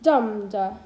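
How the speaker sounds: Dum-da, strong Dum then light da; stress on Dum. Shown as a demonstration. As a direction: punch the first beat in Dum-da. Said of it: "A stressed followed by an unstressed syllable".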